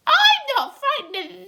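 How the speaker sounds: high, loud defiant bug; centipede shouting. Acted. Centipede defying the cloud men, high and laughing. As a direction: brag with a laugh. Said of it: "shouted the centipede".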